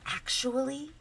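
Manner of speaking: hushed aside, trailing into a whisper; confiding whisper. Acted. Cinderella confides about her butler, hushed whisper. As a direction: lean in and whisper it secretly.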